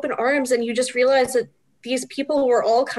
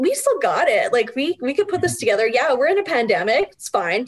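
ordinary talk, nothing marked

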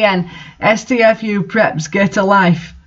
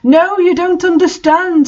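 much higher, melodramatic wail; mock sad scream; 11 semitones higher than usual. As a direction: plead in over-the-top despair. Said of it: "screamed Draco sadly".

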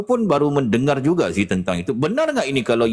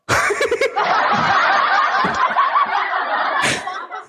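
long high laugh. Real, not acted. Laughing at own joke.

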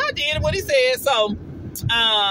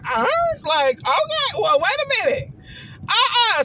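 taken aback; 10 semitones higher than usual. Retold or imitated. Retells own protest at being hushed.

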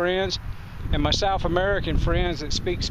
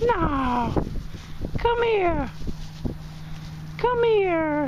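much higher, sing-song coaxing call; calling animals over; 13 semitones higher, much slower than usual. Real, not acted. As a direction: coax the llamas to come closer.

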